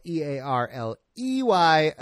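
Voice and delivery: "E A R L E Y" spelled letter by letter; spelling it out. Shown as a demonstration. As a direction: spell out "E A R L E Y" one letter at a time. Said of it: "early is spelled".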